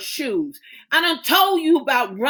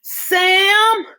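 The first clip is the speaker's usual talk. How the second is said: high, loud reassuring call; mother calling to her daughter in labor; crying out; 11 semitones higher, 5 dB louder, slower than usual. Acted. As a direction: call ahead while running down the hall. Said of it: "she cried as she ran".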